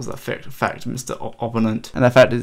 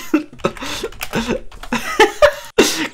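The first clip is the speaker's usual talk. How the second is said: long, high wheezing laugh; helpless laughter. Real, not acted. Streamer cracking up at the player's reply, wheezing laugh.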